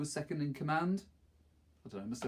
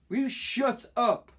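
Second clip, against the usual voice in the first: much higher, louder, slow and furious; angry snap; 10 semitones higher, 7 dB louder, slower than usual. A narrator's reading. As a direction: Maxie snapping at the boys to shut up. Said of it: "Maxie snapped at the two of them".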